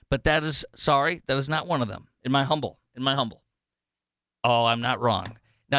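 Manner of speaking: normal talking voice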